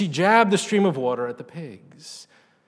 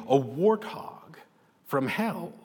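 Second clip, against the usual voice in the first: tearful; 4 dB quieter, slower than usual. A narrator's reading. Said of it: "she said tearfully".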